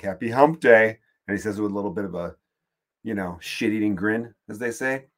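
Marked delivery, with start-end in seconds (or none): 0.0-1.0 a hint of a smug office guy, louder; office hump day guy. Coworker greeting the office on Wednesday with a smug grin. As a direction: toss it out with a cheesy grin. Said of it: "that guy on Wednesday where it's like".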